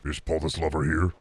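low, soft put-on villain voice; Moltar. Streamer trying a requested character, low and soft. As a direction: drop deep and quiet.

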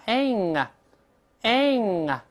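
ang as "eh" gliding into a back-tongue ng; front A plus NG. Shown as a demonstration. Teacher builds the sound, ang with front vowel and nasal ending. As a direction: open ang wide like "eng", then close at the back. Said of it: "先发哎，再拱舌根".

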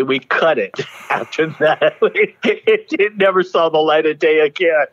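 high-pitched, laughing through the words. Real, not acted. Performer recalls a mortifying flop, laughing. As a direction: tell it while cracking up. Laughs at own embarrassing story.

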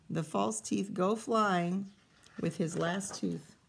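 ordinary delivery

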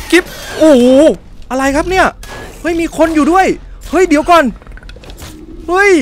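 high, loud panicked yelps; startled shout. Real, not acted. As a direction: jump out of your skin. Startled by an enemy. (ร้องตกใจเสียงสูงดัง)

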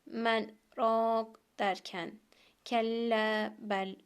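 slow chanted recitation, brief stop without breath; Quran recitation with sekte. Shown as a demonstration. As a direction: recite slowly, pause the voice but keep the breath. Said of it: "buralarda sekte yapıyorsunuz". (sekteli yavaş Kuran tilaveti)

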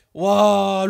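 loud startled exclaim; shocked gasp. Real, not acted. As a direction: gasp from a fright. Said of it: "il m'a fait peur".

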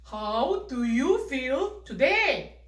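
put-on slow, deliberate model sentence for kids; 천천히 또박또박. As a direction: say each word slowly and loudly, like a teacher model. (아이들에게 천천히 또박또박 들려주는 탐 목소리)

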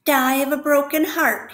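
the rat mimics Wilbur's plea with scorn; mocking mimicry. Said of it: "he mimicked".

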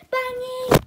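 high, loud shriek of bunny; scream. Acted. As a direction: scream the name in alarm. Sudden loud scream of name.

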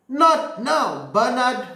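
a hint of a distracted mum; mother waving her son away; lightly voiced busy mother. Mother brushing Bernard off.

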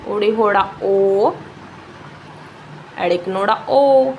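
slow, with each closing ਓ drawn out; name the sign, then hold ਓ long; vowel pronunciation demo. Shown as a demonstration. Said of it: "ਇਹਨਾਂ ਨੂੰ ਬੋਲਣ ਦਾ ਤਰੀਕਾ ਦੇਖੋ".

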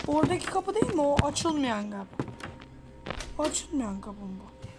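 ordinary talk, nothing marked

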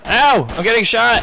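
high, loud yelp; alarmed cry. Real, not acted. Player telling friends of being shot, alarmed cry. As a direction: cry out under fire.

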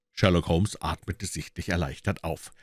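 usual speaking voice

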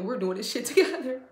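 high, loud burst through laughter; laughing excitement. Real, not acted. Reader rallying her collective, laughing and excited. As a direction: laugh as you rally everyone, voice jumping up.

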